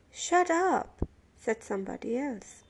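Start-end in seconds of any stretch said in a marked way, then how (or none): 0.1-1.1 high, loud command; fed-up sleeper yelling across the car; irritated yell. A narrator's reading. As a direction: silence them sharply. Another prisoner yells for silence.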